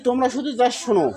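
usual speaking voice

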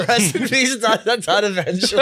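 high laughing correction. Real, not acted. Friends joking about merch, laughing correction. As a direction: crack up on the words. Laughing at rest in peace joke.

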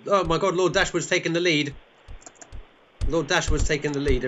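high, loud burst of astonishment; excited race commentary. Pretend. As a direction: call out a shock overtake. Race leader suddenly overtaken.

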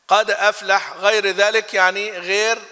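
ordinary delivery

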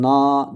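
na hecesini uzatarak "naaa" de; uzun elifli a. Shown as a demonstration. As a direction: na derken a sesini tut, elif duyulsun. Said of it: "dediğimizdeki şu elif". (na hecesi uzun a ile, örnek gösterir gibi)

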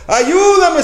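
very high, loud plea for help; desperate cry. Retold or imitated. Woman with cancer begs God for help. Congregant's prayer voiced.

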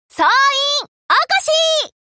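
high, drawn-out wake-up call; long carrying call across the ship. Acted. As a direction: call all hands to wake up. Wake-up call to all hands.

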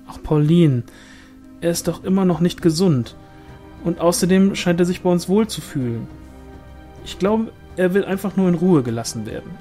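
pleading objection, voice lifted; protective woman pushing back. Acted. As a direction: defend him to a nosy relative. Irene defends the angel in argument.

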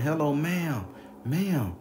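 high-pitched calling out, not full volume; retold yell. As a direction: call for the lady at her window. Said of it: "I'm yelling".

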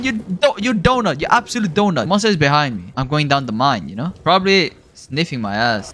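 usual speaking voice

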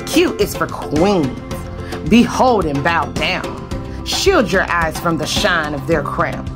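plain reading voice

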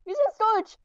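urgent high-pitched callout; repeating it in a panic; being chased. Real, not acted. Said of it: "He's chasing me in cafeteria".

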